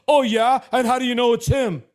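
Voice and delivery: mocking skeptic. Mocking smokers in the back, a loud, high sneering question. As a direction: sneer it like a doubting heckler. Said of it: "and go".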